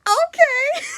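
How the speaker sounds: high squeaky okay dissolving into laughter; flustered laughing okay. Retold or imitated. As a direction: squeak it out, then crack up.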